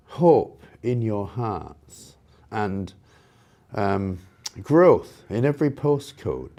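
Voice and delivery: slow, drawn-out mock of a politician's slogan; mocking Burnham quote. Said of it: "when Andy Burnham said".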